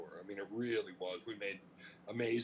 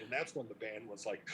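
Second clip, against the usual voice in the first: usual speaking voice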